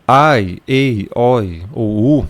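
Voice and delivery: "ai, ei, oi ou u" with each vowel sounded; diphthongs as written. Shown as a demonstration. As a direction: spell out "ai, ei, oi ou u" slowly. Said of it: "você não fala como tá transliterado".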